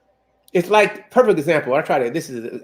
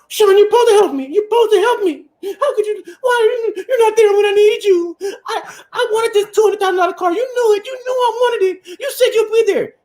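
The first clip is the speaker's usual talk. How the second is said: much higher, hammed-up whiny sob; mock-tearful wife accusing her husband of not helping; mock crying woman; 13 semitones higher than usual. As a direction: wail it like a spoiled wife in fake tears. Mimicking a wife crying over car.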